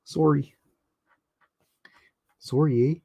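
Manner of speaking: lightly put-on Canadian accent; short, mock-Canadian apology; a hint of exaggerated Canadian. Shown as a demonstration. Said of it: "the Canadian accent".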